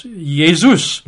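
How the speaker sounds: Jesus said yay-ZOOS; Jesus the Greek way, starting with a Y sound; Greek pronunciation. Shown as a demonstration. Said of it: "and in Greek it's".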